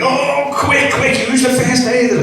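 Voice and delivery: high, urgent panicked call. Retold or imitated. Deputy head runs into the staff room, high panic. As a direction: burst in shouting for help.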